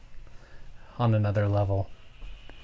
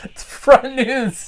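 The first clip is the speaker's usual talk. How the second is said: slow mock emphasis; 11 semitones higher, 5 dB louder, much slower than usual. Mock slow news announcement.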